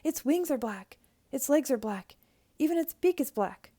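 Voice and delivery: put-on excited child spotting clues; building excitement. A narrator's reading.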